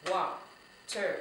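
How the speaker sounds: "Wa-ter-ba-tle" in four separate, spaced beats; syllable by syllable. Shown as a demonstration. Teacher counting syllables with children, "Wa-ter-ba-tle" chopped into four beats. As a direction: break "Wa-ter-ba-tle" into syllables, pausing after each. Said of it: "How many syllables was that".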